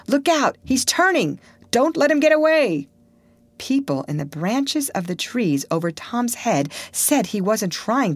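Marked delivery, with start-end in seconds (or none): 0.1-2.9 excited crowd. A narrator's reading. Townsfolk shouting as Potter approaches, high and alarmed. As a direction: warn the crowd he may run.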